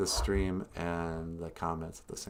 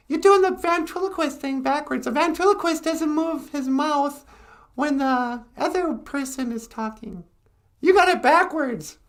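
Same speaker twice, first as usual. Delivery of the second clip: puppet voice; 8 dB louder than usual. Acted. Puppet Natasha introducing herself, very high and loud. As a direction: squeak it out as the puppet.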